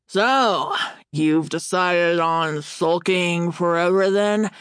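lightly muffled, as if eating; mouth full. A narrator's reading. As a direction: ask it through a bit of stew. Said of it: "asked through a mouthful of lentil stew".

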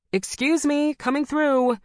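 high, loud call through a crowd. A narrator's reading. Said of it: "I called".

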